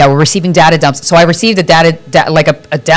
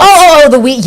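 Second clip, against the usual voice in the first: high, loud oh oh oh; excited realization; 10 semitones higher, 4 dB louder than usual. Real, not acted. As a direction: suddenly get it, excitedly. Sudden recognition.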